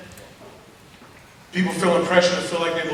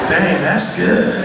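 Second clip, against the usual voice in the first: slow impressed teacher; 7 dB louder, much slower than usual. Retold or imitated. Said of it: "saw some teachers ... like this".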